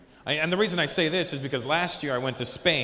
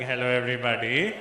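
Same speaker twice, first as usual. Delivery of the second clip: lisping king; 4 semitones lower than usual. Retold or imitated. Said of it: "he's like".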